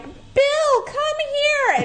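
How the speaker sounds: high-pitched mock yell to a husband; imitating a yell. Woman calling her husband to the door, imitated yelling. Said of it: "she yells back to her husband".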